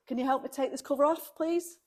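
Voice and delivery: normal talking voice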